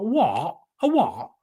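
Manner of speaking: high Brummy "What? A what?"; childhood Brummy accent. Retold or imitated. Streamer showing his old Brummy accent, high and broad. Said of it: "I used to sound like a Brummy".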